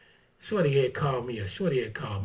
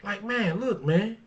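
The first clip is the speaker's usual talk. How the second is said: higher, indignant mock confrontation; talking back to a troublemaker, higher and indignant; pretend telling off; 8 semitones higher than usual. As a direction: tell the fool off, voice raised high. Acting out telling someone off.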